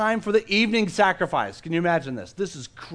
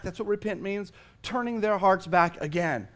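normal talking voice

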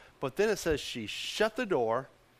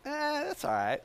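high, offhand dismissal; shrugging it off; 9 semitones higher, 4 dB quieter than usual. Acted. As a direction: brush it off with a pitched-up 'eh'. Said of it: "She's just like".

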